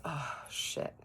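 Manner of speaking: breathless whispered curse, caught out; whispered oh shit. Retold or imitated. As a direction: let it slip as a shocked whisper. Bob caught out, retold.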